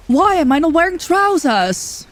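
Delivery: high, drawn-out mock British complaint; over-the-top British outcry; terrible British accent. Shown as a demonstration. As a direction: wail it in a badly faked British accent. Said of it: "a terrible British accent".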